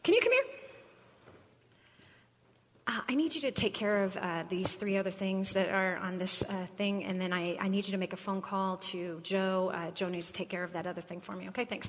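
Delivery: turning away to hand off tasks; distracted aside to staff. Manager distracted, ordering staff aside.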